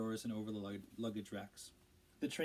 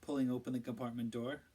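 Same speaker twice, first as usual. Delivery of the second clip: usual speaking voice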